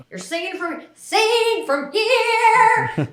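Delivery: high, slow, half-sung mimic of a vocal coach; imitating grandma's coaching. Granddaughter imitating her grandmother's singing critique, high and sung. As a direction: sing the correction back in grandma's voice. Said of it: "And she's like".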